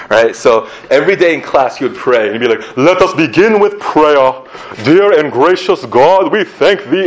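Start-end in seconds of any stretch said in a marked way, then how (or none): 2.8-7.1 hammed-up British accent, slow, loud and high; terrible British accent. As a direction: pray grandly in a bad British accent. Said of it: "My British accent is terrible".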